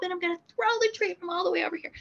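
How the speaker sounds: mock high, fast patter; exaggerated high and fast. Trainer hamming up how far she throws the treat, high and fast. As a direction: gush it out quickly, high-pitched. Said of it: "I'm exaggerating a little bit".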